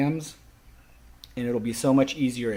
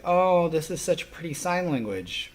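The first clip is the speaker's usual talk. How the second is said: higher-pitched imitation of an admiring onlooker; mock admiration; 7 semitones higher than usual. Teacher mimicking students just sitting there watching signing, mock admiration. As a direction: lightly mimic a gushing spectator.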